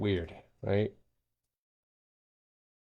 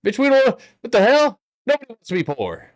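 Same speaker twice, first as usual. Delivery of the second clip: exasperated shout; 12 dB louder than usual. Real, not acted. Exasperated retort to the whining.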